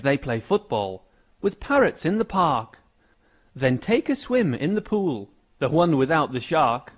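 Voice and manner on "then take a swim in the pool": plain reading voice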